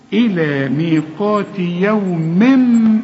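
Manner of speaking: يوم with a held nasal m into معلوم; slow recited, humming the mim; slow tajweed demo; ghunna on tanween. Shown as a demonstration. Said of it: "وهسمعها لك تاني".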